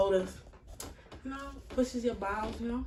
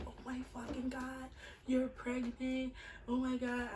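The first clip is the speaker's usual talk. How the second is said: shocked sister; 5 semitones higher, 7 dB quieter than usual. Retold or imitated. Sister shocked at positive test.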